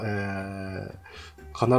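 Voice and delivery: usual speaking voice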